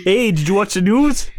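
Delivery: hammed-up Canadian accent; put-on Canadian lilt. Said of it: "do the Canadian voice".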